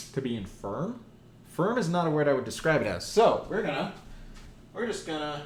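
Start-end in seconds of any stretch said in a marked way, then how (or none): none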